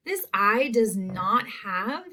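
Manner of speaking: usual speaking voice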